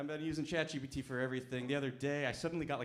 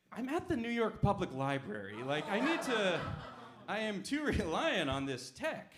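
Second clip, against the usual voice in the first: mock excuse; 6 semitones higher, 4 dB louder than usual. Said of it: "And then I was like".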